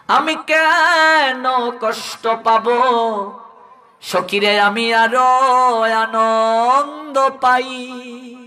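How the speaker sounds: slow, drawn-out devotional delivery; blissful Radharani. Acted. Radha answers her companions that she feels joy, blissfully drawn out. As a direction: stretch the vowels out in a lilting reply. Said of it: "Radharani বলছেন".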